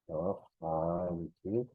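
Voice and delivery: Ñojanchik drawn out very slowly, rising at the end; teacher modelling Ñojanchik; very slow demo. Shown as a demonstration. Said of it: "no es ñojanchik".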